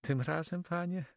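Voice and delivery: high female character voice; Flemish girl, grateful. Acted. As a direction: thank him in a high lady's voice. (wdzięczny, wysoki głos dziewczyny)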